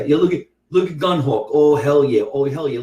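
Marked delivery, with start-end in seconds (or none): none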